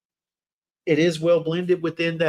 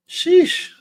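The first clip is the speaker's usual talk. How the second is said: impressed sheesh; 13 semitones higher, 6 dB louder than usual. Real, not acted. Reacting to strong fragrance.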